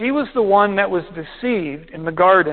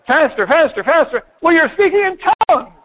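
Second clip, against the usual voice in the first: mock instructor, rising higher and louder, then quick; urging faster and faster; 8 semitones higher, 3 dB louder than usual. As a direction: builds to a high, fast pitch as if rushing him.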